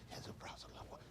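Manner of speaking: barely audible, voiceless whisper; hushed funeral whisper. Acted. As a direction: whisper it as if at a wake. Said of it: "why you talk quiet".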